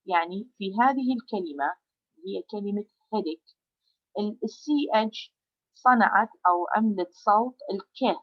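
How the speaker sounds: ordinary delivery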